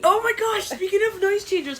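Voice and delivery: high, loud excited burst; thrilled about the new toy. Real, not acted.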